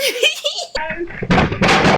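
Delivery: high-pitched laugh after boasting. Real, not acted.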